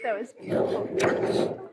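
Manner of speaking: slow, breathless whisper. Real, not acted. Guest after the sister's toast, in a moved whisper. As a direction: barely breathe the words out, moved.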